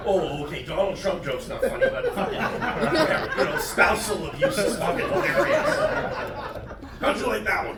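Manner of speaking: loud, laughing mock outrage at the crowd; hammed-up huffy rant, laughing; mock indignant. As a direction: scold the crowd through laughter. Mock-heckling the audience.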